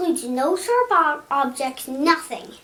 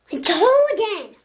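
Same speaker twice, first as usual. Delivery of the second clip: mock surprised gasp; 7 semitones higher, 4 dB louder than usual. Pencil reappears whole.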